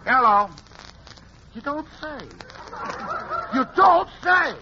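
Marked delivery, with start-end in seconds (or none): none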